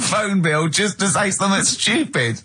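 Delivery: high, helpless laughing delivery; laughing while talking. Real, not acted. Comic confesses his pointless prank call, cracking up. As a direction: squeeze the words out through laughter.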